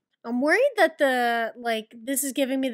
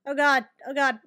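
normal talking voice

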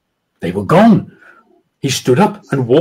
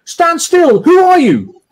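high, louder scream; 4 dB louder, slower than usual. A narrator's reading. Drunk sergeant screaming at the socks, high and loud. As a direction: yell it at the unseen thing. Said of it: "was now screaming at the socks".

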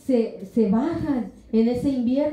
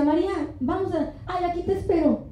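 husband's excuse; 6 semitones higher, faster than usual. Retold or imitated. Husband voiced, distracted by tablet.

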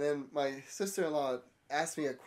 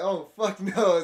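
loud, slow and laughing; a laughing, drawn-out oh hell no; loud laughing refusal; 5 semitones higher, 10 dB louder, slower than usual. Retold or imitated. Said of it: "I'm like".

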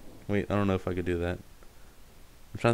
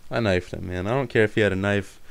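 ordinary talk, nothing marked

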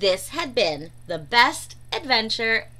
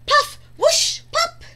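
very high, loud puff whoosh pop; squeaky sound effects; 7 dB louder than usual. A narrator's reading. Panicked creatures make sound effects.

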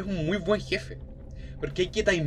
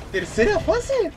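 much higher, slow dread; alarmed high question; slower than usual. Real, not acted. Player fearing a third boss phase, alarmed high question. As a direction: ask it in horror.

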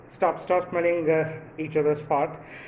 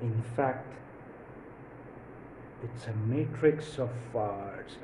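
Neo; 7 semitones lower, 10 dB quieter than usual. Pretend. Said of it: "Neo would go something like".

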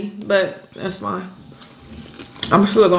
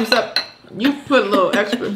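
usual speaking voice

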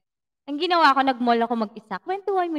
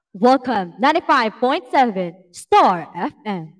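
radio DJ voice; 3 semitones lower, 6 dB louder than usual. Pretend. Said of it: "feel na feel ko talaga".

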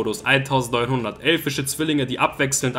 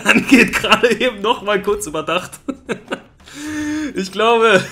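higher, louder words through laughter; laughing while talking; 8 semitones higher, 5 dB louder than usual. Real, not acted. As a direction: laugh out the sentence. Laughing at card.

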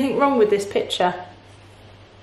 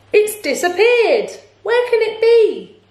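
high, louder wail of alarm; alarmed cry; 10 semitones higher, 4 dB louder than usual. A narrator's reading. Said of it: "cried Bernard".

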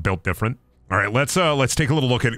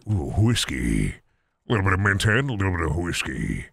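low, lingering connoisseur murmur; savouring whiskey voice; 5 semitones lower than usual. Pretend. Playful savouring voice over found whiskey.

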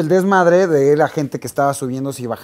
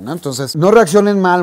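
ordinary talk

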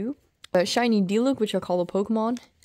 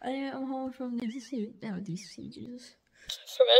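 usual speaking voice

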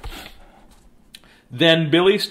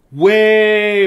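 stretched high storyteller word; drawn-out high; 7 semitones higher, 8 dB louder than usual. A narrator's reading. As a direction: draw it out high.

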